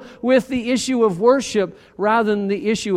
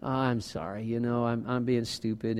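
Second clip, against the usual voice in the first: soft apology; 7 semitones lower, 11 dB quieter than usual. Retold or imitated. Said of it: "eventually there's the".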